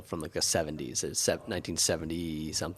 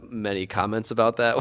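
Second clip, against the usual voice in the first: ordinary talk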